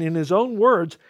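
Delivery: normal talking voice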